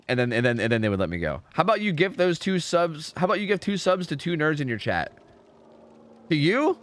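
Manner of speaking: ordinary talk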